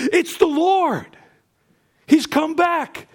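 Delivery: very high, drawn-out joy; amazed exclamation. Acted. Peter realizes it's the Lord, amazed. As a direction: cry it out slowly, soaring upward.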